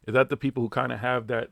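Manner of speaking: ordinary delivery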